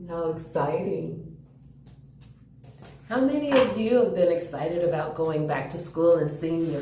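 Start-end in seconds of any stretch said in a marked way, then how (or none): none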